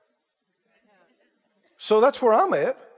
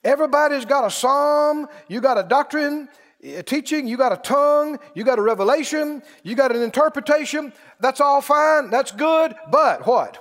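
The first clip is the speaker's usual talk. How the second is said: raised preaching voice, building; 4 semitones higher than usual. Acted. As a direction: preach it out to the church. Preaching to congregation.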